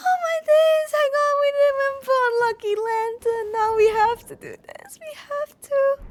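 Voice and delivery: high, loud and fast, half-crying; frantic joy. Real, not acted. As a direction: rush it out giddy and teary. Overjoyed, rushing on.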